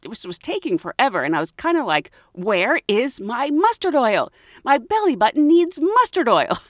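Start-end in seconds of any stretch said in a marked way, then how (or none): 2.3-6.6 mock impatience. Impatient waiting for mailed oil.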